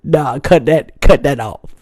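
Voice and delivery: lightly voiced fiance shutting it down; fiance, jealous. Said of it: "My fiance would be like".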